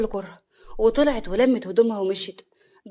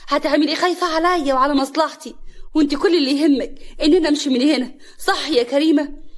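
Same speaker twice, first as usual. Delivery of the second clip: loud, high accusing questions; heated accusatory retort; angry confrontation; 5 semitones higher, 5 dB louder than usual. A narrator's reading. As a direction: challenge her, raised and indignant. Wife angrily confronts sister-in-law.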